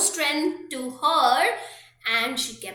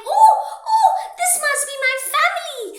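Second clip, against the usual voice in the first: high, fast, breathless with joy; excited girl; 11 semitones higher, 8 dB louder, much faster than usual. Acted. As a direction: squeal it out, racing with hope. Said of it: "she was excited".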